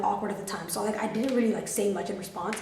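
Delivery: ordinary delivery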